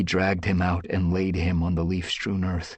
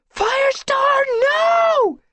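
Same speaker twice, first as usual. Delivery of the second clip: very high, loud scream of horror; horrified scream; 9 dB louder, much slower than usual. Acted. Squirrelpaw screaming at Firestar under the falling tree, horrified. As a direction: scream his name as the tree lands.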